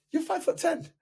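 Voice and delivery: high disbelieving squeak; incredulous question. Retold or imitated. Coach asks client in disbelief about his height. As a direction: pitch up in disbelief. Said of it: "He goes".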